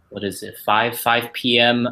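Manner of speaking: ordinary delivery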